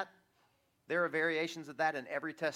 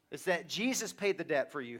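ordinary delivery

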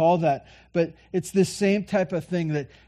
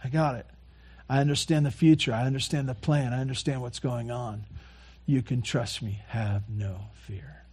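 soft, calm reassurance, slowing at the end; quiet comforting voice, acted; reassuring Jesus; 2 semitones lower, 8 dB quieter than usual. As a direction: settle their fears gently, fading to a hush. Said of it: "Jesus says".

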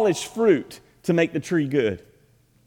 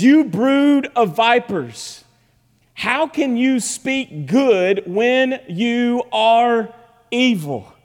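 high, slow preached denunciation; forceful rebuke; 8 semitones higher, 5 dB louder, much slower than usual. Acted. Preacher reads Jesus rebuking the vipers, forceful rebuke. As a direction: stretch each accusing word with force.

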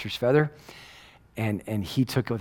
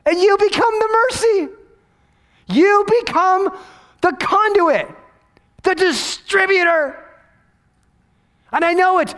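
much higher, louder, slow preaching peak; soaring climax; 6 dB louder, slower than usual. Acted. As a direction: lift the whole room with each word. Preaching at full force.

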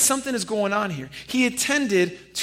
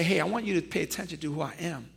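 soft aside; 4 semitones lower, 9 dB quieter, faster than usual. Acted. Jesus voiced softly.

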